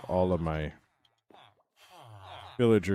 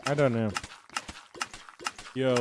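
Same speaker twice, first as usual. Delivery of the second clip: ordinary talk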